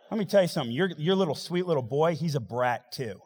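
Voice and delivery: ordinary talk, nothing marked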